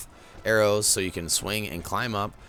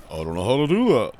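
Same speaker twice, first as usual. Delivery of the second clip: Paz impression; 3 semitones higher, 7 dB louder than usual. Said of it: "In the famous words of our good buddy Paz".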